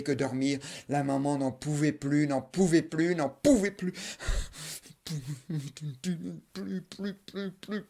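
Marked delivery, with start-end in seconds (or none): 5.0-7.8 high, soft worn-out whine; exhausted whimper. Acted. Mother at the end of her rope, high soft whimper. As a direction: she has nothing left.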